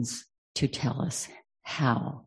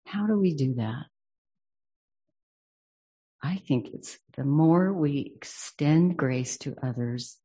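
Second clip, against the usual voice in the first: ordinary delivery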